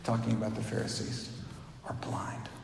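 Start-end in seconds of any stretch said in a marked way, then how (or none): 1.9-2.5 breathy hush on the last words; hushed whisper, no voice; whispered punchline. Acted. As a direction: drop to a whisper to land the point. Jesus' punchline about Pharisees.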